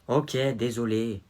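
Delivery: normal talking voice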